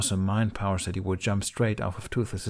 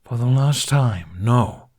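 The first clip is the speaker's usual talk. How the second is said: louder, slow and weary; exasperated retort; 3 semitones higher, 8 dB louder, slower than usual. Acted. Toothless answering Hiccup's nagging, loud and exasperated. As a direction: drag out the annoyed no.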